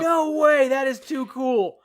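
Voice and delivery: high, loud, delighted disbelief; real amazement. Real, not acted. Fan thrilled by a surprise cameo, loud amazement. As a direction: gush over great news, floored.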